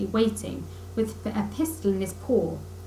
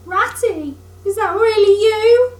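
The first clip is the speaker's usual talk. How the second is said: ordinary delivery, nothing marked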